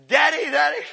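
loud, high imitation of a mad kid; mock angry child. Preacher imitating children mad at their parent, loud mock anger. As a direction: yell at Dad like a cross child, played for laughs. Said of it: "they get mad at you and say".

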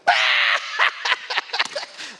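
loud high yell, imitating friends; high laughing shout; loud mock shout. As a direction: friends bellow at him, hammed-up.

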